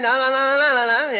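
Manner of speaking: loud, rapid-fire protest; mock refusal. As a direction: imitate someone refusing outright. Retold refusal by panicking interpreter.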